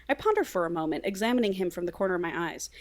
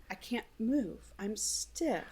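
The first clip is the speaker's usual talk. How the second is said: very soft, dawning bewilderment; small, stunned voice; hushed alarm; 13 dB quieter, slower than usual. Acted. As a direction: barely voice it, as if just waking unable to move. Character wakes up paralysed, acted.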